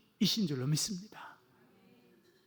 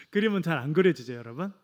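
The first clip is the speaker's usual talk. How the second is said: ordinary talk, nothing marked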